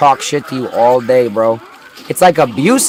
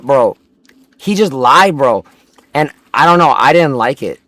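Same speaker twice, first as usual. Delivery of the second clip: ordinary delivery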